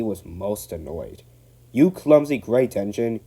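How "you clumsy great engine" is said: louder angry outburst; stormy signalman. Acted. Signalman storming at Donald, angry. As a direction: storm at the engine. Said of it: "he stormed".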